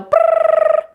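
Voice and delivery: very high, loud trilled brrr; a sharp high trill to catch a dog's eye; high-pitched attention noise. Shown as a demonstration. Photographer calling a distracted dog's attention, high trilled brrr. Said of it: "a high-pitched noise".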